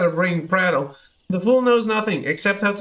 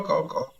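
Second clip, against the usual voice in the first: caws dropped lower and much softer; 4 semitones lower, 6 dB quieter than usual. Acted. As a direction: let the caws die away low. Birdman caws.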